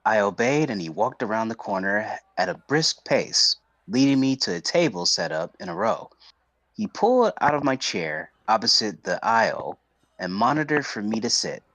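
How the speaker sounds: ordinary delivery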